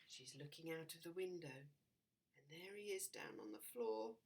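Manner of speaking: plain reading voice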